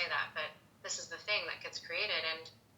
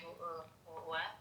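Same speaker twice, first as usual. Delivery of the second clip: much softer, stammered disbelieving what; stunned disbelief; 7 dB quieter, slower than usual. Retold or imitated. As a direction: lose the words in shock. Stunned by dad's support.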